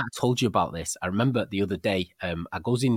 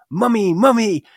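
high, loud imitated child's call of mummy; 13 semitones higher, 9 dB louder, slower than usual. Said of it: "dead loud like that".

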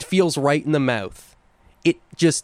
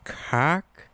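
put-on mid-Atlantic accent; 3 semitones higher, 4 dB quieter than usual. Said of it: "that mid-Atlantic accent".

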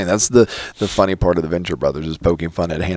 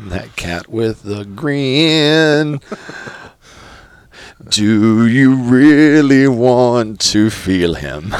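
very slow, loud, drawn-out sly voice; slow cartoon line; 4 semitones higher, 4 dB louder, much slower than usual. Host quotes the cartoon villain slowly and loudly. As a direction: stretch it out slyly. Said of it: "cartoon quote".

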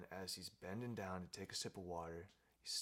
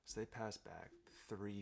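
ordinary delivery, nothing marked

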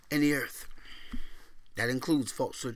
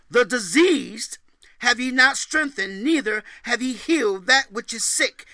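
loud, high preached scripture; scripture reading; 7 semitones higher, 6 dB louder than usual. Acted. Preacher booming scripture to the congregation. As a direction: proclaim the verses like a prophet. Reads Ezekiel aloud in sermon.

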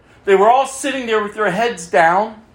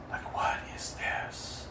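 unvoiced, slow whisper of disbelief; 18 dB quieter, slower than usual. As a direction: breathe it out in shock. Dark room, shocked aside.

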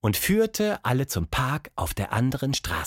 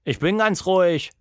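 put-on higher-pitched indignant child protest; 7 semitones higher, 3 dB louder than usual. A narrator's reading. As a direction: insist against being told to calm down. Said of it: "protestierte Tilda".

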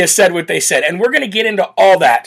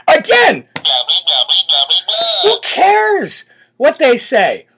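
exasperated outburst; 3 semitones higher, much slower than usual. Real, not acted. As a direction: throw the question out in disbelief.

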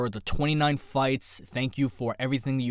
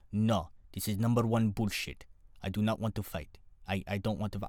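bad Russian accent, low and soft; Khabib; 4 semitones lower, 6 dB quieter than usual. Retired fighter refusing promoter, poorly faked Russian accent. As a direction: imitate a stubborn refusal in a terrible Russian accent. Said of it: "my Russian accent is terrible".

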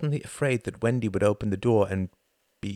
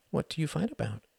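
put-on child asking; 8 semitones higher than usual. A narrator's reading. Jocelyn asking.

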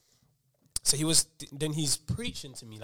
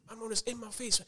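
usual speaking voice

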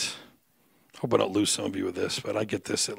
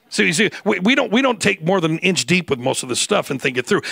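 loud, high preaching at full pitch; fiery preaching; 11 semitones higher, 9 dB louder than usual. Acted. Preacher pleading with the congregation at full force.

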